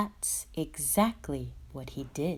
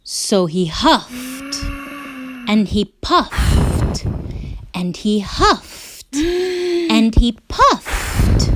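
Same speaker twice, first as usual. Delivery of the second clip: dramatic narration; 8 semitones higher, 10 dB louder, much slower than usual. A narrator's reading. Narrator builds tension.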